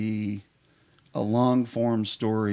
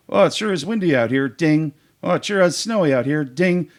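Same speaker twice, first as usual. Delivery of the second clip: high, loud mock small talk, building higher; acted improv scene; 8 semitones higher, 6 dB louder than usual. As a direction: play a hammed-up scene with bell dings. Acting out an improv game example.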